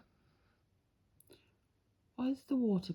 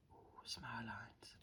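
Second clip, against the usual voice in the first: hushed whisper while concentrating; 9 semitones lower, 20 dB quieter than usual. Real, not acted. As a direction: breathe it out softly. Concentrating on delicate nail art.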